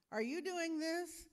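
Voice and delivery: stern mom. Acted. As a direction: catch the kid out.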